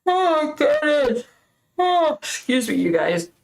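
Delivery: an unexpected high squeal of oh my goodness; startled high-pitched exclaim; sudden high squeak. Real, not acted. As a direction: voice jumps way up by surprise. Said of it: "I don't know where that came from".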